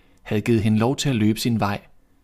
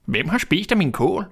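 higher little dwarf voice; dwarf's voice; 7 semitones higher than usual. A narrator's reading. Fourth dwarf asking about his cabbage, higher voice. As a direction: ask it as a puzzled little dwarf, pitched up. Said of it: "sagde den fjerde".

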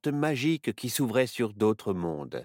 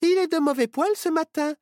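put-on much higher girl voice, teasing; girl's voice; 5 dB louder than usual. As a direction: Myrtille teasing her grumpy friend, higher girl voice. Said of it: "dit Myrtille".

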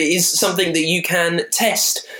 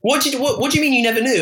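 incredulous; 8 semitones higher, faster than usual. Real, not acted. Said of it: "disbelief at co-host".

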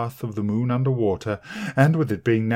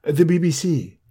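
high, chummy, self-important producer; fussy little TV man; 6 semitones higher, 7 dB louder than usual. A narrator's reading. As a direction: talk down to the sexton breezily. Small TV man voiced.

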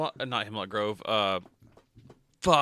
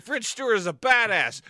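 excited quote; 9 semitones higher than usual. Retold or imitated. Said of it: "I was like".

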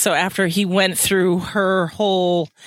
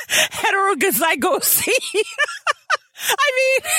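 cracking up mid-word; shouting through laughter; laughing fit. Real, not acted. Stumbling over a hard word.

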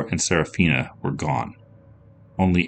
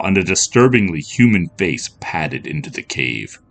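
ordinary delivery

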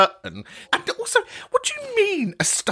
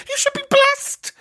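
very high camp pronouncement; high diva sass at the caller. Acted. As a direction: deliver it as a high diva line. Camp exclamation.

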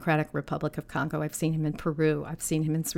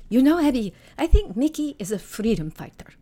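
higher-pitched imitated Somali accent; 5 semitones higher, 5 dB louder than usual. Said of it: "in her Somali accent".

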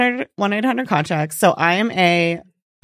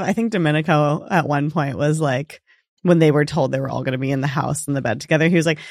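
ordinary talk

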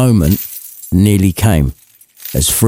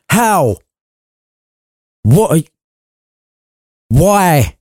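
higher, broken-off startled questions; stammering shock; 8 semitones higher, slower than usual. Acted. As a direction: fumble for words in disbelief. Scrooge stammers at the ghost.

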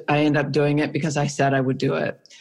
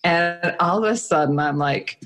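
ordinary delivery, nothing marked

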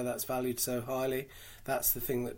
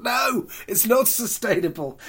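high, loud, drawn-out protest; exaggerated retelling of a firm no; emphatic refusal; 10 semitones higher, 9 dB louder, slower than usual. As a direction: cry out a comic protest, close to laughing. Retelling his emphatic answer to boss.

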